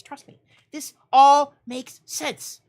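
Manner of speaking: slow, loud, over-emphatic reassurance; mock insistence. Host convincing viewers of silly logic, slow loud insistence. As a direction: insist it all makes sense, dragging it out. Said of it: "Obviously, guys".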